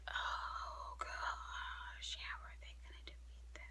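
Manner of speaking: very soft, voiceless inner thought; whispered worry. Retold or imitated. Voices worried remembered thought.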